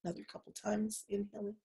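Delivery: very soft, almost under the breath; hushed cue. Acted. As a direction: murmur the cue softly. Quiet cue between toning.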